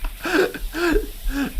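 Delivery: squeaky high laugh; high laughter. Real, not acted. Laughing at the scene.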